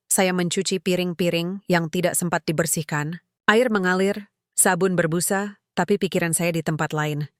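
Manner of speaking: ordinary delivery, nothing marked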